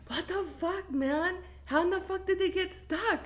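high-pitched, stunned disbelief; high, incredulous retold question; mock bewilderment. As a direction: imitate a baffled kid. Retold stunned kids' reaction.

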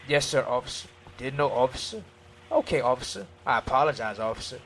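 low, soft, submissive replies; humble and deferential. Acted. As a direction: answer the officer meekly and politely. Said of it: "Just humbling yourself".

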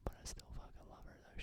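soft, voiceless whisper. Real, not acted. Boyfriend confides he still loves his girlfriend, whispering. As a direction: whisper it like a secret to the listener. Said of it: "Why are you whispering".

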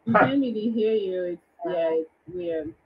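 ordinary talk

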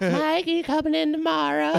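high old woman's voice, imitating the dean's scheduler; a sweet little old lady calling a student. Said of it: "thousand-year-old woman named Dottie".

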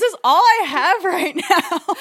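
high, loud words swallowed by laughter; slow, high and loud, laughing through the words; laughing hard. Real, not acted. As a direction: crack up at your own misery. Laughing about her crying.

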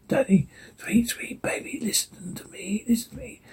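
distorted ghostly whisper. Acted. Daddy's warped voice luring Jane, whispered. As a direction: breathe it out, eerie and warped. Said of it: "Dad distorted voice".

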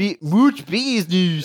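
put-on high, loud, slow South African accent, laughing through it; over-the-top South African accent; mock South African accent. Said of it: "It's a South African accent".

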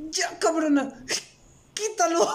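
high, whining plea with a sob in it; whiny pleading. Retold or imitated. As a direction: beg her to take it away. Pleading with ASMR video eater.

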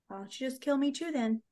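soft, calm retold retort; imitating calm. Host quoting Katniss facing Snow's threat, calmly. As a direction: say it quietly, as if unbothered. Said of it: "she so calmly is like".

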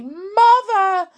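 high, loud urgent shriek; high-pitched alarmed shouting. A narrator's reading. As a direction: yell frantic orders at the top of the voice. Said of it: "shrieked Missus Cranky".

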